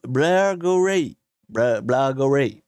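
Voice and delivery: slow, loud mock-Scottish place name; attempted Scottish accent. Shown as a demonstration. Streamer showing viewers the town's name, slow attempted Scottish accent. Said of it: "Prononcé avec un accent écossais".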